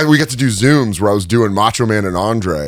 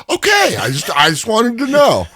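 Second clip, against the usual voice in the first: 1 sheepish high reply; 13 semitones higher than usual. Retold or imitated.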